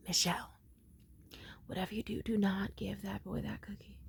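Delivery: hushed, mostly whispered urgent advice; confidential whisper. Retold or imitated. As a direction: whisper it so the kid can't hear. Leaning over, confiding past the child.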